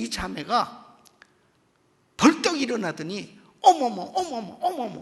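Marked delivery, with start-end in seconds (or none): 3.6-5.0 louder amazed exclamations; imitating a woman startled to find she is healed; astonished gasp. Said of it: "막 이러는 거야". (놀라서 감탄하는 흉내)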